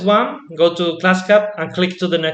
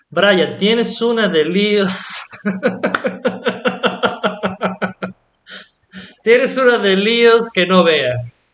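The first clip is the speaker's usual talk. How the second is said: louder "tienes una de líos" with weight on "una"; emphatic una; 4 semitones higher, 4 dB louder than usual. Shown as a demonstration. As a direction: tease Brian with strong emphasis on "una". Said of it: "if you want to sound a little bit more emphatic".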